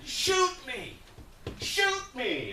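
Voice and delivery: high, loud yell of desperation; screaming man. Retold or imitated. Homeless man yelling across the park, screaming. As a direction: scream it out high, stretching the first word. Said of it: "this guy's screaming".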